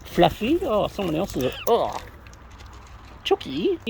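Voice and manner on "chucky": very high-pitched call to a bird; high coo. Real, not acted. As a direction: sing the name up high like calling a pet. Cooing to pet birds.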